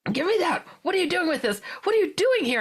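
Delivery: scolding intruders while grabbing things back; indignant demands. Retold or imitated. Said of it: "I'm talking really fast to them".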